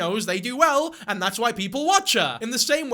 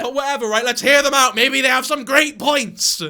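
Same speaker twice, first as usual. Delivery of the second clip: loud, raised sarcastic build-up; 5 semitones higher, 9 dB louder than usual. Real, not acted. Vlogger urging viewers to hear them out, loudly sarcastic. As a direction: proclaim it with exaggerated open-mindedness.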